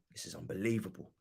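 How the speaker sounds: very low, hushed exasperation; deflated disbelief. Real, not acted. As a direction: drop right down, barely voiced disbelief. Deflated disbelief after the outburst.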